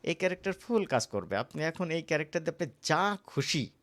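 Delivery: usual speaking voice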